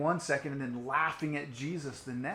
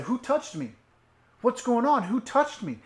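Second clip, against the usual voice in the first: louder, higher urgent call; a raised, searching Jesus; urgent demanding call; 8 semitones higher, 8 dB louder than usual. Acted. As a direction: insist on knowing who touched him.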